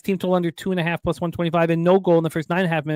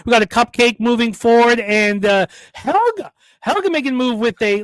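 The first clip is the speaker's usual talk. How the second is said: higher, louder play-by-play; race announcer; 6 semitones higher, 5 dB louder than usual. Acted. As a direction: call the race with rising energy. Calls the food race.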